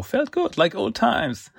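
pleased boast. Retold or imitated. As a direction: savour the win. Raph bragging to Casey.

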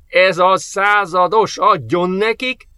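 high, drawn-out cheering call; jubilant whoop. A narrator's reading. Sergeant cheering the enemy's destruction over communicator, jubilant whoop. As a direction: whoop it out slowly, egging him on. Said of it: "kurjantotta a kommunikátorba".

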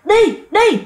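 high, sharp barked command; angry shout. Retold or imitated. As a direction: yell it while swinging the stick. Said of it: "ông ngoại đánh".

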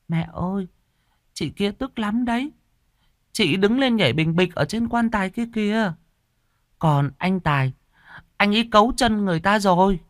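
put-on high childlike voice; young boy telling his mother about the ghosts; little boy. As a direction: speak as an innocent small boy pointing. Boy Hùng speaks again.

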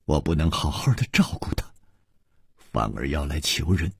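soft, desolate near-whisper; sorrowful. Acted. A woman confesses she failed to care for her, sorrowful and hushed. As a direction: murmur it low and sorrowfully. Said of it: "她凄然说".

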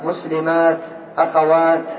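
"مسلمات، أخوات" ending in clear t; final t kept. Shown as a demonstration. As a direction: stop on "مسلمات، أخوات" with an audible t. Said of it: "يقفون بالتاء".

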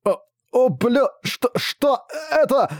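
high panicked cry, slow at the end; terrified shriek. Acted. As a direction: scream out in horror. King sees crushed skull in terror.

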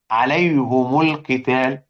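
عليهم القتال read very slowly as alayhumu l-qital; slow damma reading. Shown as a demonstration. Teacher showing Hamza and Kisai's reading, slow and careful. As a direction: sound out عليهم with u on the ha and the mim. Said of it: "يقرأوا على الأصل".

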